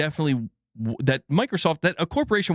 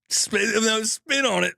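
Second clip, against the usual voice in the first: laughing correction; 6 semitones higher, slower than usual. Real, not acted. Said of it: "Oh my God".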